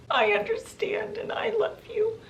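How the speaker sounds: high, breaking whisper; mother's tearful whisper. Retold or imitated. Mother reassures Meg from afar, in an emotional whisper. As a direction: whisper it lovingly through a choked throat. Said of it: "she hears her mother's voice".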